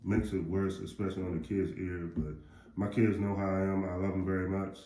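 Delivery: ordinary talk, nothing marked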